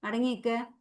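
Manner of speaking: ordinary talk, nothing marked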